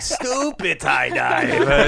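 slow, drawn-out mock shout; drawling playful jeer; roast catchphrase. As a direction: stretch the insult out as a roast. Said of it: "roasting people always with".

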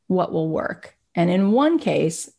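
usual speaking voice

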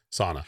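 sauna as "SAW-nuh"; local American pronunciation. Shown as a demonstration. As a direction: keep sauna with an open "ah". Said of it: "we're gonna stick with".

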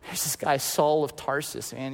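hushed, low, confiding instruction; imitated whispering. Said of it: "God is whispering to Ananias".

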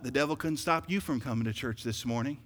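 ordinary talk, nothing marked

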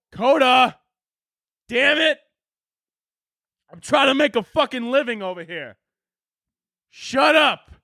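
high-pitched shout at the dog; exasperated yell. Real, not acted. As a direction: call out sharply, fed up with the dog. Yelling at his whining dog.

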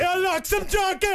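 mock Jamaican vendor. Jerky seller greets a customer in a mock Jamaican accent. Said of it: "let's do an act out".

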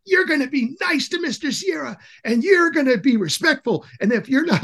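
imitated school leader's high-pitched telling-off; mock yelling. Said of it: "was just yelling at them".

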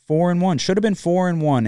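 a burst of betting frustration; frustrated outburst. Real, not acted. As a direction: hammer the record you should have had. Repeating the missed record in frustration.

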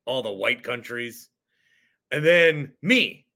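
ordinary delivery

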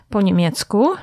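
ordinary talk

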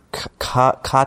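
usual speaking voice

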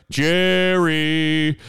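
loud, drawn-out call; imitated heckler. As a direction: holler the name from the crowd, stretching it.

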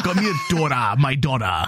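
lightly mock a Spanish soap-opera father; Telemundo voice. Host mimicking the alien father, lightly voiced telenovela accent. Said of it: "became a voice of Telemundo".

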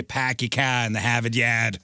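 put-on dropped-R Boston accent. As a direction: do a Boston local, no Rs. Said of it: "Locals really do say".

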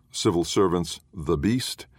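ordinary talk, nothing marked

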